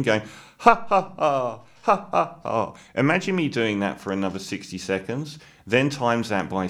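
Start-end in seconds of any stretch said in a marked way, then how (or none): 0.6-2.7 deliberately forced, spaced-out "ha ha ha" laugh; fake laughing. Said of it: "someone's doing fake laughing".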